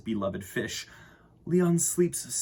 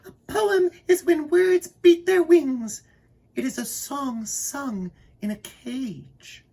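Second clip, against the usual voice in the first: very high, loud, birdlike chirp; puffed-up canary voice, high and bright; 7 dB louder than usual. A narrator's reading. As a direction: chirp it like a proud little bird. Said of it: "Aristophanes chirps".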